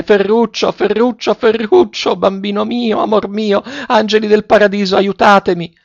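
very high-pitched, despairing calls; desperate cry. A narrator's reading. Grandmother calling her dying grandson and begging for help, desperate cry. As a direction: call the name over and over, pleading desperately at the top of the range. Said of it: "con quanta voce avea in gola disperatamente".

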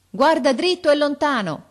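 high, loud officer's call; shouted order. A narrator's reading. Officer to the boy up the tree, shouting. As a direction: shout it up to someone far off. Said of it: "gridò l'ufficiale".

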